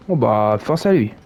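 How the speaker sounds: usual speaking voice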